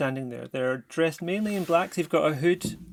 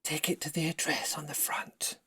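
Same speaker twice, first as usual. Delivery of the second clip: whisper; 4 dB quieter than usual. Acted. Stranger whispers delivery instruction.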